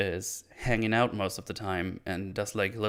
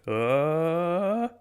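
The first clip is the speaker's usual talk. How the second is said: long "uh" sliding steadily up high; imitated wordless wail climbing in pitch; drawn-out rising noise; 7 semitones higher than usual. Shown as a demonstration. Storyteller standing in for showing the fight, rising drawn-out noise. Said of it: "just go like".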